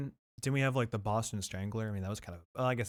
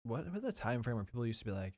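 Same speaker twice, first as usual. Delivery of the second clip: normal talking voice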